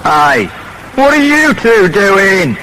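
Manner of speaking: high, loud angry call across the room; guard shouting. A narrator's reading. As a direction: shout at the two kids to stop. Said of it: "someone shouts".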